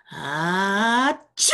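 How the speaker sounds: big acted sneeze. Reading the story, acting out Josh's sneeze. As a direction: act out a loud achoo.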